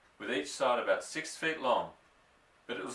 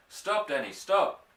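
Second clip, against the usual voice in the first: urgent warning shout; 7 semitones higher, 4 dB louder, slower than usual. A narrator's reading. Father in the pit shouting a warning to his son, urgent. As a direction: stop him with a sharp shout. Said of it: "he shouted".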